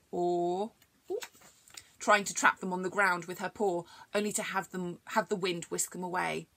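ordinary delivery

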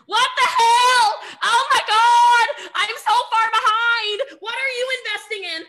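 hammed-up alarm at falling behind; mock panicked shout. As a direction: speaker shouting to fellow top earners in mock panic. Peers panic on private chat.

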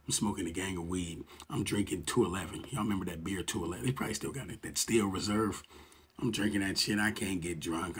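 ordinary delivery

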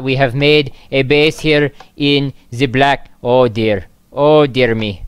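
put-on terrible Russian accent. Player narrating day thirty-two outside the vault, bad Russian accent. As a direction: put on a hammy Russian accent, measured and deliberate. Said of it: "Why are you talking in terrible Russian accent again?".

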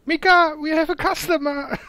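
high, loud delighted cry; excited shout. Acted. Shopkeeper calls out to wife.

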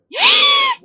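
shrill-high, loud squealed gasp; excited gasp. Real, not acted. Gamer sees the aliens arrive, loud excited gasp. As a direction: gasp loudly with overwhelmed excitement. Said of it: "me emocioné muchísimo".

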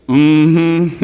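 grandma's warning; sassy disapproving mm-hmm. Acted. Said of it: "You're like".

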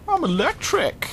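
high playful squeal of delight; silly falsetto outburst. Pretend. Playful echo of weapon name.

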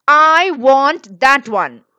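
put-on loud, drawn-out childish demand; shouted demand. A narrator's reading. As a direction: shout it slowly, leaning on each word. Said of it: "shouted Anil".

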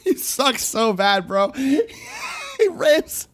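words squeezed through helpless laughter; cracking up. Real, not acted. Streamer mocks the owner on screen, cracking up. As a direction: laugh so hard you can barely talk.

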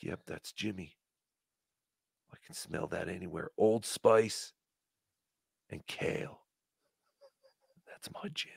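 soft, hushed swooning; mock dreamy murmur. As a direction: whisper it like a lovesick fan. Mock sniffing Jim lovingly.

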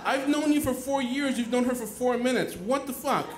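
hammed-up high-pitched outrage at a friend; indignant. Telling off a friend of four years, indignant. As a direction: argue it high, an exasperated retold protest.

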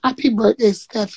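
ordinary delivery

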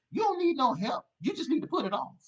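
soaring preacher cry; preaching high and loud. Real, not acted. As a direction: lift the voice way up and exhort. Preacher exhorting congregation.

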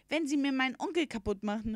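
put-on high, indignant boy's warning; calling out a threat. A narrator's reading. Boy threatening the horse for his uncle, calling out. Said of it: "rief er".

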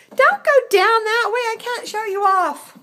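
high, loud protesting wail; calling after a pet. Real, not acted. Owner trying to show off her cat, high loud protest. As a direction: plead loudly in high-pitched pet talk. Mock-scolding the cat walking away.

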